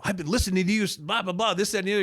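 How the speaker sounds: high, gushing fan chatter; starstruck fan. Retold or imitated. Starstruck teen gushing to the program director, high and excited. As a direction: gush at your radio hero. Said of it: "I go".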